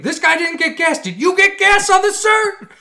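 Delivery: hammed-up shop clerk hailing a customer; imitating a cashier calling to another customer.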